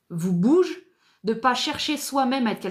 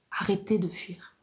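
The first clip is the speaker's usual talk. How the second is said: breathy urgent whisper; 5 dB quieter than usual. Shown as a demonstration. Coach urging listeners in a whisper to stop running away. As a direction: breathe the order right into their ear.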